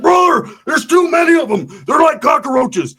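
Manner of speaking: very high, drawn-out panicked cry; mock panicked yell. Roach with the pipe is outnumbered, mock panicked yell.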